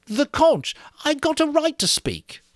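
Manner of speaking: high, loud insistence; insistent Piggy. A narrator's reading. Piggy to Ralph, insistent. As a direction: demand the right to speak.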